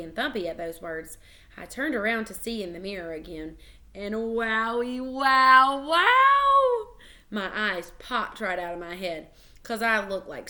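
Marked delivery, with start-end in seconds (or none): 3.9-7.0 drawn-out wows climbing from slow to very loud and high; amazed wow. A narrator's reading. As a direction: build to a huge astonished holler. Sees clown face in mirror.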